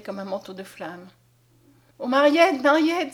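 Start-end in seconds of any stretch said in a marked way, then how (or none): 2.0-3.1 louder, higher overjoyed cry; exclaiming. A narrator's reading. Blind Conscience to Mariette, seeing again, joyful cry. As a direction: burst out with her name. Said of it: "s'écria Conscience".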